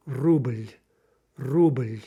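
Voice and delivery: "Ruble" as the Russian word, L held at the end; syllabic final L. Shown as a demonstration. Teacher giving another Russian example, syllabic L. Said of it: "depends on how you say it".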